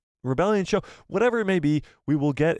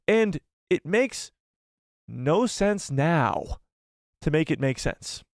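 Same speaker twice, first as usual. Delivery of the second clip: slow, drawn-out emphasis; slow emphatic; much slower than usual. Real, not acted. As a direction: spell it out word by word. Slowed emphatic.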